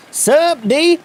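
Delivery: high, cocky scooter dude voice. Acted. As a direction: toss it off like a cool scooter kid. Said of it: "I get to do my scooter voice again".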